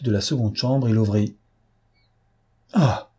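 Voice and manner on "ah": short, high gasp of dismay; stunned cry. A narrator's reading. Lupin finds the room empty, stunned cry. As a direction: cry out as if stopped dead. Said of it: "interdit, bouleversé".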